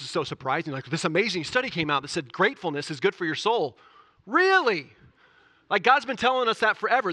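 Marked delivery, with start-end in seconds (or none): 4.3-7.1 sarcastic really, pitched much higher and louder; mock surprise. Pastor mocking a study's surprising finding, sarcastic. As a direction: scoff at the obvious news.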